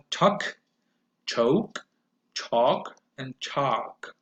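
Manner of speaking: nut, note, not, not with the mouth opening wider; slow on the last not; vowel series demo. Shown as a demonstration. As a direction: model each vowel. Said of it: "my mouth opens wider".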